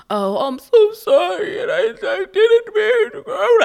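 high, drawn-out fake blubbering; mock crying apology. Drunk colleague turning on the tears to apologize, mock crying. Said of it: "like, crying and being so apologetic".

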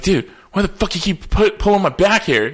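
high, loud, irritated protest; mock annoyed. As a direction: snap at the teammate pulling your hair. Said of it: "and so I'd be like".

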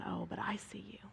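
soft, hushed voice of a comforting God; quiet and intimate; tender reassurance. Retold or imitated. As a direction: reassure her very gently, almost under the breath. God gently answering the weeping maid.

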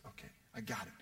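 whispered reply; whispered okay in the pew. Retold or imitated. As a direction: breathe it back without voice. Whispering back in church.

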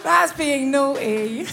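slow words through laughter; giggly, drawn-out admission; laughing. Real, not acted. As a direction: speak while cracking up. Still laughing at her joke.

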